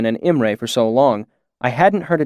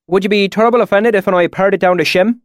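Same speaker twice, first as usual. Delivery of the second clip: higher, louder rural dialect; put-on dialect; 8 semitones higher, 4 dB louder than usual. A narrator's reading. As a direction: quote folksy wisdom in dialect. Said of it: "my sudden change in dialect".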